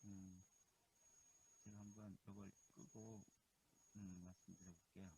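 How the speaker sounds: barely audible phone line; faint connection. Real, not acted. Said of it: "무슨 말을 하긴 하는 것 같은데 안 들려요". (멀리서 작게 들리는 통화 소리)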